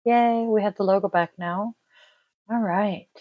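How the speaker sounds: ordinary talk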